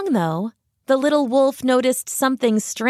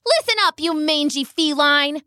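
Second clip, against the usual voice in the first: high, bossy yell; shouting; 5 semitones higher than usual. Acted. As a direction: bark it at the lion queen. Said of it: "he shouted".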